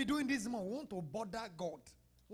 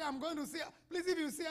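higher pleading; 7 semitones higher, faster than usual. Retold or imitated. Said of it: "I say".

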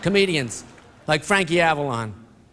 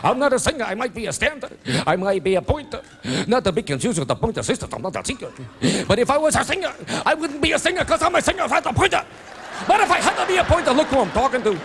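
Jackie Mason impression; 6 semitones higher, much faster than usual. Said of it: "Singers like Jackie Mason".

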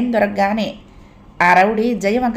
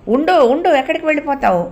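rowdy calling after her; 7 semitones higher than usual. A narrator's reading. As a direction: call her back, leering and pushy. Rowdy grabbing at fleeing woman.

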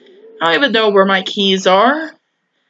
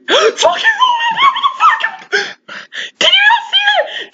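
real startled scream. Real, not acted. Gamer to viewers after a jump scare, startled shriek. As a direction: scream it, genuinely scared out of your skin.

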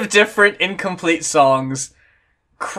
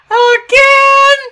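much higher, louder sudden cry; a loud exasperated squeal; high exasperated again; exasperated yelp; 7 dB louder than usual. Real, not acted. Exasperated outburst.

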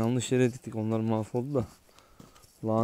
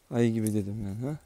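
usual speaking voice

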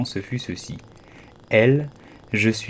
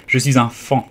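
joyful burst, louder; delighted cry; 3 semitones higher, 7 dB louder than usual. Acted. Fawn to Alice, a joyful louder cry. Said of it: "s'écria-t-il d'une voix ravie".

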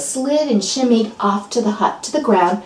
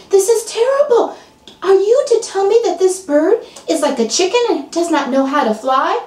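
high, appalled voice; outraged on the eagle's behalf; dismayed friend; 10 semitones higher than usual. Acted. Friend protests the eagle can't fly, high and appalled.

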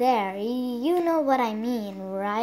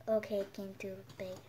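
normal talking voice

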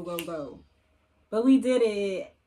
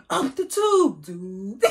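Henry Danger quote; 7 dB louder, much slower than usual. Retold or imitated. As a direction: belt it like the TV character. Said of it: "they be".

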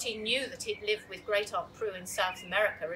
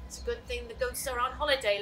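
ordinary delivery

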